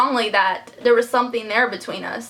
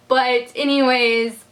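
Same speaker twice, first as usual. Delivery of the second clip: ordinary delivery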